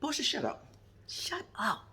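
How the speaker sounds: high, soft mock scold; sassy mock shut up; telling Portia off. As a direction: snap at her with sass.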